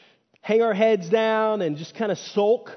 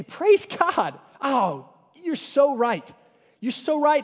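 high, bright-eyed thankful gush; joyful praise; 7 semitones higher, slower than usual. Retold or imitated. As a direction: gladly take the rebuke, voice lifting high. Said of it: "but I could say".

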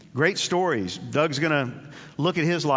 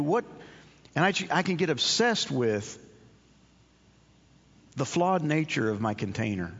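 ordinary talk

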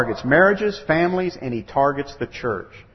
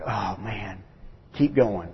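low, soft, sly devil gloating; gloating Satan; 5 semitones lower, 8 dB quieter than usual. Retold or imitated. Preacher voicing Satan gloating over a family quarrel, low and sly. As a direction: savor it like a villain rubbing his hands. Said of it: "rubbing his hands going".

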